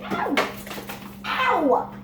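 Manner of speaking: high sharp ow of pain; pained cry. Real, not acted. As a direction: yelp in sudden pain.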